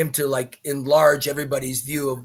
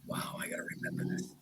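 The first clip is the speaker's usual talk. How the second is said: whispered thought to himself; awed whisper; 15 dB quieter, faster than usual. Retold or imitated. Shy young man beside Muhammad thinking, awed whisper. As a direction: breathe it in hushed wonder.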